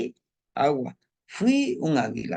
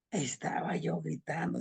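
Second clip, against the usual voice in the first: whispered; 3 semitones higher, 8 dB quieter than usual. Retold or imitated. Retelling his birth cry.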